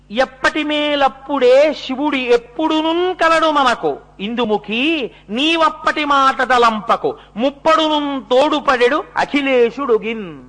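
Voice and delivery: high, loud chanted Telugu verse with drawn-out syllables; verse recitation. Acted. Husband consoling his worried wife in verse, high chanted recitation. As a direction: declaim the poem slowly, high and strong.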